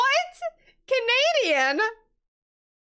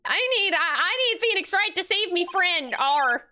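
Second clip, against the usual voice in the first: high pirate attempt with a closing arr; 8 semitones higher, 4 dB louder than usual. Streamer retrying the pirate client's plea, mock pirate. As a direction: push through a hammed-up pirate plea. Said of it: "how does one speak in pirate?".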